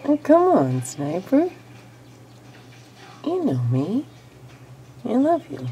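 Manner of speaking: loud, high pleading with a pet; loud playful pleading with a lizard; excited coaxing. Real, not acted. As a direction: coax the lizard, laughing it off. Coaxing lizard after it puffs.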